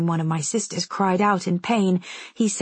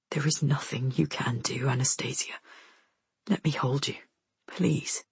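low, very soft man's voice; hushed, gentle plea; tender; 5 semitones lower, 6 dB quieter than usual. A narrator's reading. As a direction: comfort her in a near murmur. Soldier comforts terrified girl.

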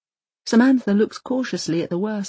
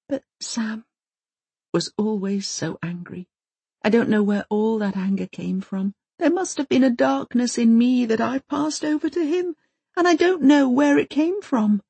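crushed whisper; 5 semitones higher than usual. Acted. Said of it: "Maud whispers, quite crushed".

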